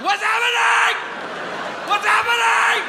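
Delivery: very high, loud mock wail; shrieking tearful teacher, acted; crying, hammed up. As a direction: wail it in hysterical, put-on panic. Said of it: "Why are you crying so much".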